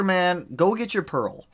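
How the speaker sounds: ordinary talk, nothing marked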